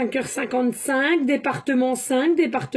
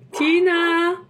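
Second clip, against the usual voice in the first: calling the dog; 3 semitones higher, 4 dB louder than usual. Real, not acted. Calling her barking dog.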